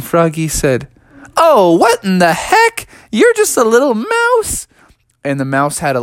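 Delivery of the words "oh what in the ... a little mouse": loud, high-pitched disbelief; acted incredulous discovery; astonished. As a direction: exclaim it, climbing to a squeak. Froggy discovers it's a mouse.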